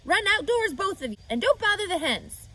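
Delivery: Mrs. Zuckerman, loud and brisk; Mrs. Zuckerman shooing. Acted. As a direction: chase the kids out of the kitchen. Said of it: "aunt sends children out".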